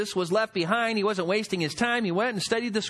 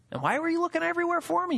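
mother frantic; 9 semitones higher, faster than usual. Retold or imitated.

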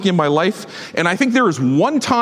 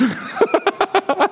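amused laugh; 14 semitones higher than usual. Real, not acted. Laughing at Jeremiah's excuse.

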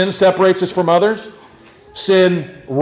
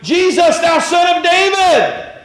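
high loud shout for mercy; crying out; 13 semitones higher, 5 dB louder, slower than usual. Acted. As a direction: yell it across the crowd. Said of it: "and he's like".

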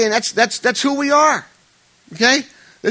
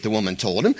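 ordinary talk

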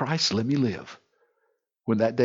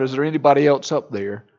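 mock holler; 7 dB louder than usual. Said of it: "you holler out".

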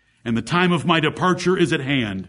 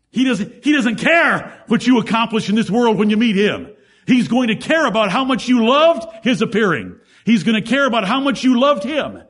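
louder, insistent preacher's drive; preaching; 7 semitones higher, 6 dB louder than usual. Acted. Preacher pressing the congregation, raised and louder. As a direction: push the congregation hard.

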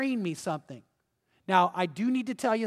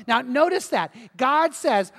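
ordinary delivery

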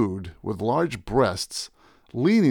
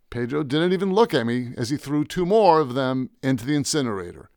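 plain reading voice

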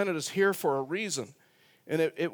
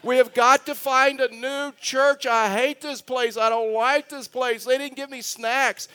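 put-on higher, louder whiny kid's wail; hammed-up child tantrum, pitched up; mock screaming child; 7 semitones higher, 3 dB louder than usual. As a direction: act out a small boy's tantrum over snacks. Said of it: "like, screaming".

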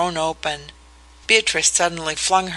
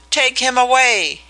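high, loud panicked cry to send him away; shrieking in horror; terrified shriek; 7 semitones higher, 4 dB louder than usual. A narrator's reading. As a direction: scream it out in terror at a ghost. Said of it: "he shrieked".

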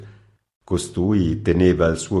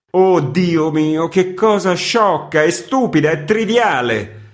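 exasperated outcry; 11 semitones higher, 6 dB louder than usual. Acted. Editor's exasperated outburst.

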